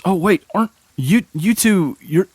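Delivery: high, eager recognition; light up on seeing the heroes; excited guard. Acted. Guard Greg recognizing the party, high and excited.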